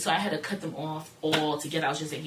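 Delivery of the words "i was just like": normal talking voice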